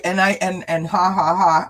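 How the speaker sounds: ordinary delivery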